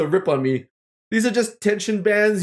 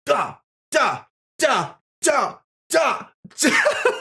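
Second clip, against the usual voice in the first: short cries climbing higher; rising effort cries; 7 semitones higher, much slower than usual. Real, not acted. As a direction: yelp with each pull. Mock dance while stretching.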